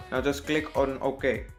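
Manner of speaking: ordinary delivery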